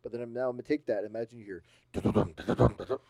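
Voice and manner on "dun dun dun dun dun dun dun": loud, rattling bursts of "dun"; imitated galloping. Shown as a demonstration. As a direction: beat out hooves galloping after someone. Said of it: "just something galloping".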